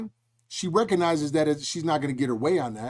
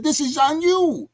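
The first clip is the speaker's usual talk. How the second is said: high, drawn-out exclamation; retold exclamation; 10 semitones higher, 5 dB louder, slower than usual. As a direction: stretch it out high and louder, retelling it. Retelling what he told his wife.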